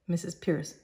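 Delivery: normal talking voice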